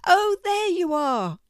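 pleased, high-pitched cat; happy cat. A narrator's reading. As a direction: call out happily in a high cat voice. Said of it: "said Seymour happily".

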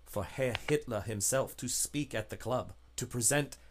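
soft, guarded informant voice; hushed, grave and wary; secretive contact. Acted. Contact warns of dangerous man.

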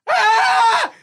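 sudden high scream, laughing through it; startled high shriek from the bottom bunk; retold scream. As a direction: shriek like you woke to a shadow. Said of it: "So I just scream".

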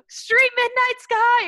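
high, drawn-out happy shout; excited yell. Retold or imitated. Fan announcing to a Sonic drive-through guy, excited yell. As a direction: holler it out like a giddy fan. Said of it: "telling the guy at the window".

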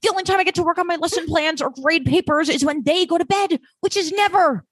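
high, put-on imitation of Kate; like S1's dad. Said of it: "it sometimes sounds so much like your dad".

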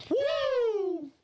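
high, loud wrestler woo; Ric Flair woo. Acted. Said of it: "Two claps and a Ric Flair".

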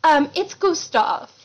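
lightly put-on refined correction; fancy smooth talker. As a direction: draw out "Gustav" with mock elegance. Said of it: "real fancy kind of talking, like, real smooth".

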